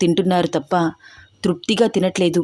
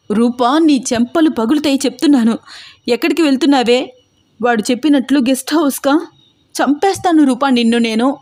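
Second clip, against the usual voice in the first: shouting sister; 6 semitones higher than usual. Acted. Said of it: "గట్టిగా అరుస్తోంది దీప".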